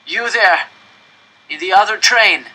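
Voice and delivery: high, loud shout from afar; calling out. A narrator's reading. Voice from another train, loud distant shouting. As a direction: yell to be heard over the gap. Stranger calls across tracks.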